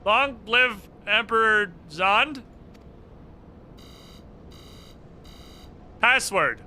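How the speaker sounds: yelling. Real, not acted. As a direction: yell the password at the machine. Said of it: "We're just yelling shit at the ball".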